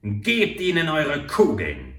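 loud, slow, grim order; war chief ordering his men to shoot; fierce command. A narrator's reading. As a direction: order the volley with hatred. Chief commanding his warriors to fire.

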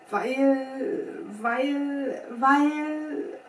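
halting stutter, pitch creeping up; embarrassed stammer. A narrator's reading. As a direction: stall on the word, flustered. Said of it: "stotterte der Kapitän verlegen".

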